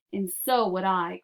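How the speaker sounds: usual speaking voice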